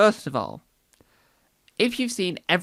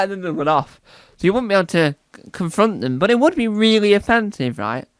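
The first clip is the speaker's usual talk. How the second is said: ordinary talk, nothing marked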